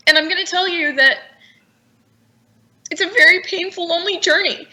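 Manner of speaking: high, strained voice close to crying; tearful. Real, not acted. Telling the host of her lonely journey, tearful and strained. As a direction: push the words out through tears. Emotional testimony continuing.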